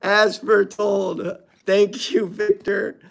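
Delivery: high, giddy and close to tears; elated. Real, not acted. Thanking Victor for the luck, giddy and close to tears. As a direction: gush with joy, almost crying. Elated after the lucky pull.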